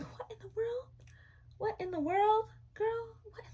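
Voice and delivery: be totally baffled by this stranger; baffled exclamation. Retold or imitated. Retelling her confused reaction to the stranger, high and baffled.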